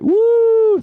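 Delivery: loud, high whoop; cheer. Real, not acted. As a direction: whoop to send the crowd off. Cheering to close the show.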